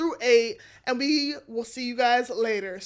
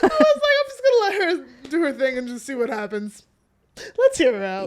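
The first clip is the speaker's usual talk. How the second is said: mock self-quote; 2 semitones higher, 5 dB louder than usual. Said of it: "I was like".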